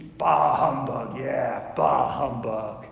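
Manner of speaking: slow, low grumpy miser; Scrooge voice. Retold or imitated. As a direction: grumble it like a grumpy old miser. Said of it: "Ebenezer Scrooge".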